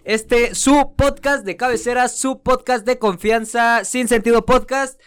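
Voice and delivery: upbeat show host opener; announcer intro. Acted. Podcast host opening.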